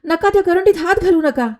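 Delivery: high, desperate plea; frightened cry. Acted. Gardener cries out to stop Meera opening the basket, high and desperate. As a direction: cry out to stop her hand. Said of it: "माळी ओरडला".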